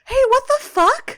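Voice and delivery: high, incredulous hey what the fuck; surprised outburst. Real, not acted. Cohost reacting to Autobot naming, incredulous yelp. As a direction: yelp it in disbelief.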